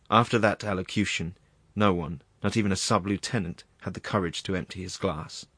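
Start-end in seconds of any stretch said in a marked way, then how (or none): none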